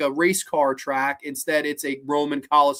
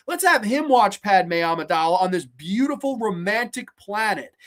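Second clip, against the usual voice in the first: ordinary delivery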